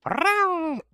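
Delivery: high, drawn-out cute trill; playful hug squeal. Pretend. Streamer hugging chat, playful high squeal.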